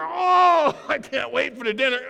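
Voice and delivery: high, loud, laughing glee; can't contain the excitement; gleeful shout. Retold or imitated. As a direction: Peter gloating over his boulder, laughing. Peter gleeful with boulder.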